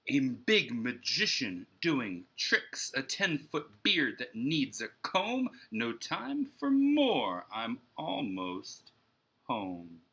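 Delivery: put-on lively, rhythmic reading to children; storybook read-aloud. A narrator's reading. Teacher reading Mulberry Street to her class, lively and rhythmic. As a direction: bounce the rhymes like a picture book.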